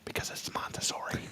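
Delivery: hushed whisper. Shown as a demonstration. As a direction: breathe it out in a whisper. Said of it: "I'm gonna whisper it".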